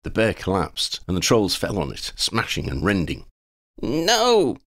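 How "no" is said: very high cry of no; shouting. Acted. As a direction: cry out as the bear falls. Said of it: "Lydia shouted".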